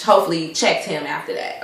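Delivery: usual speaking voice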